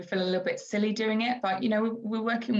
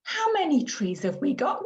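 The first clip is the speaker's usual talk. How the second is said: put-on higher, lilting question for a small child; modelling language; 7 semitones higher than usual. Shown as a demonstration. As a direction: wonder it aloud in a high sing-song. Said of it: "we might say something like".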